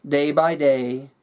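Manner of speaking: usual speaking voice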